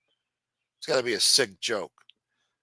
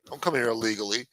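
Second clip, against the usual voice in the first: ordinary delivery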